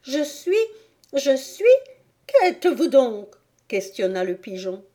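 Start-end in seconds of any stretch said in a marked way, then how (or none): 0.0-1.6 put-on high, halting girl's voice; faltering, high and unfinished; hesitant Alice. A narrator's reading. 2.3-3.4 high, loud challenge; impatient, raised-voice question; demanding pigeon. A narrator's reading. Pigeon interrogates Alice.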